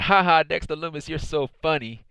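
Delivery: high, mocking fake laugh; mock laughing tease. Retelling Indy Hartwell teasing Dexter, mock laughing. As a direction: play the teasing girlfriend laughing at him. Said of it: "she started laughing at Dexter Lumis".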